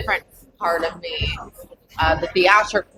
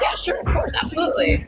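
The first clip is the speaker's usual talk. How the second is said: eager yes; 8 semitones higher than usual. Retold or imitated. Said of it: "I was like".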